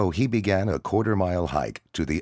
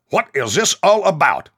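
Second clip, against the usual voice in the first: stern loud demand; 14 semitones higher, 10 dB louder than usual. Acted. Sergeant demanding answers.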